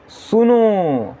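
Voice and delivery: सुनो with the final ओ held long and falling; prolonged vowel. Shown as a demonstration. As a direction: stretch सुनो out like a distant call. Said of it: "बोलने में ज़्यादा समय लग रहा है".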